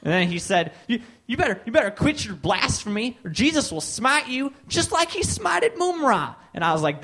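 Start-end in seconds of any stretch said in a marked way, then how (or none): 0.9-6.3 sputtering start, climbing to a high rant; mock threatening bigot. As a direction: imitate a zealot winding up to a threat. Acts out bigot's escalating threat.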